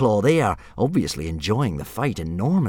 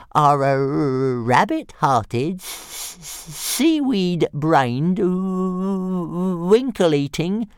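higher, very slow stuttering list of insults; stammering dragon insults; 8 semitones higher, much slower than usual. Acted. Toothless insulting Fireworm, high slow stutter. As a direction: stutter each insult out, unhurried. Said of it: "said Toothless calmly".

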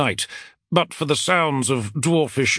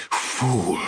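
lisping; 3 semitones lower than usual. Acted. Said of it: "she lisped".

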